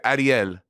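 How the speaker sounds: Ariel with a tapped R, like "ah-dee-EL"; accented pronunciation. Shown as a demonstration. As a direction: show your own way of saying Ariel, "ah-dee-EL". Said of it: "that's how I say it".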